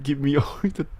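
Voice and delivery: ordinary talk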